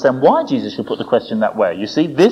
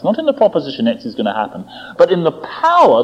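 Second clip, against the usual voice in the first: ordinary talk, nothing marked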